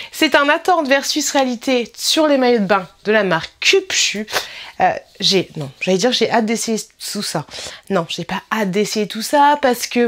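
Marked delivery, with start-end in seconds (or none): none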